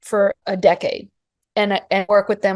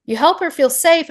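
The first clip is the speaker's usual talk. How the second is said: moved almost to tears, higher pitch; tearing up; 7 semitones higher than usual. Real, not acted. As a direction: voice lifting higher as tears come. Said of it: "I'm tearing up".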